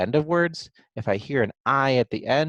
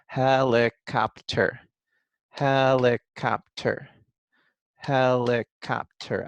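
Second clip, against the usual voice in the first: slow "helicopter" in four even, separated beats; syllable by syllable; much slower than usual. Shown as a demonstration. Teacher claps out syllables for young writers, beat by beat. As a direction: clap out "helicopter" one syllable at a time. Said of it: "clap out those syllables".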